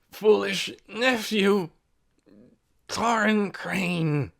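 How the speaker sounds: put-on very slow, strained old rasp. A narrator's reading. Sick old Ben rasping out his nephew's name. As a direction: rasp the words out. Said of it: "he rasped".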